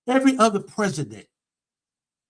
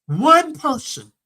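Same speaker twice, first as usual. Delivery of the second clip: slow, high, loud "One person"; emphatic; 10 semitones higher, 8 dB louder, slower than usual. Real, not acted. Preacher blaming the ex-president to her viewers, slow and emphatic. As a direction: hammer it home slowly.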